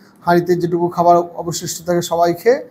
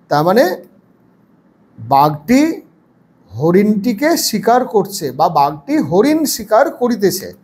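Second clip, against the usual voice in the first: usual speaking voice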